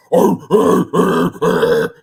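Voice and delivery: loud growling animal noise; Joe Rogan animal growl. Retold or imitated. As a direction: growl like a bear or wolf. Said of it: "every single animal he does is that right there I just did".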